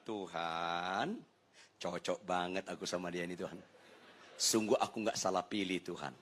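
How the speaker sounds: low, soft, patient prayer; murmured prayer, low and serene; calm mock prayer. Husband telling God his wife suits him, calm and resigned.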